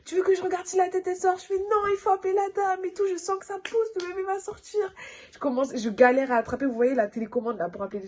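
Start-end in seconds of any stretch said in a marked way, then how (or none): 0.0-1.4 high, fast worried question; anxious question. Retold or imitated. Sister offering to check, high and hurried. 1.6-4.8 high panicked plea; panicked pleading. Retold or imitated. Woman in labour to her sister, high and frantic.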